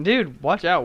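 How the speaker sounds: urgent loud warning; shouted warning. Real, not acted. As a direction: yell it out in alarm. Warning a citizen.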